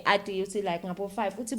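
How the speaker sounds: usual speaking voice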